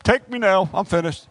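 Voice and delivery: loud, high cry settling down; giving up. Acted. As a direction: throw in the towel dramatically. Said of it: "we're like".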